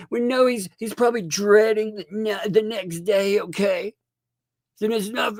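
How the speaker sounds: high-pitched sarcastic ranting; sarcastic sing-song mockery of them; mocking rant. Real, not acted.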